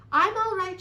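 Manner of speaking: high, loud reassuring call. A narrator's reading. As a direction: call out that you are safe. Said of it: "she called".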